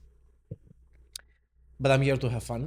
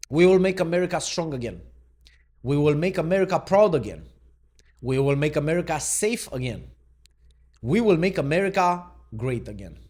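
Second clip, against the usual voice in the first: loud, raised, slogan after slogan; rally proclamation; 3 semitones higher, 6 dB louder than usual. Acted. Vlogger reading Trump's promises to viewers, rally proclamation. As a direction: declaim it to a crowd, each line falling at the end.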